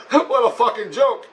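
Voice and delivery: high-pitched, laughing through the words; can barely take it seriously, laughing; scornful laughter. Real, not acted. Patient mocks the hospital's imagined reaction, scornful laughter.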